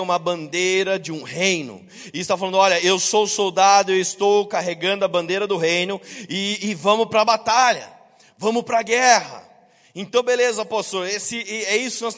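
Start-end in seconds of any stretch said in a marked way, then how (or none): 2.6-9.5 convocação firme e mais alta; brado de soldado. Acted. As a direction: chame a tropa pra batalha, projetando a voz. Soldado convoca para a batalha. (soldado encenado, conclamando com força)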